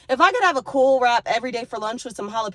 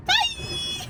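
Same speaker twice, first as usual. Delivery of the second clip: high squeaky goodbye. Real, not acted. Sign-off squeal.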